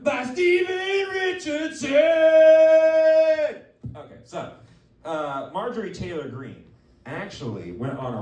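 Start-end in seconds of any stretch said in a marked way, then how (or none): 0.0-3.6 high, slow, drawn-out announcer yell; announcer shout. Acted. Host hypes the unclogging of a politician with a big announcer call. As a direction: boom it out like a ring announcer.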